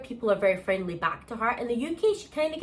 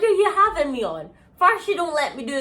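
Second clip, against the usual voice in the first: incredulous; 7 semitones higher, 6 dB louder than usual. Retold or imitated. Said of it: "I'm like".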